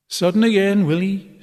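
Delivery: a shouted taunt from the crowd. A narrator's reading. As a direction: jeer it out loud. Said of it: "someone shouted".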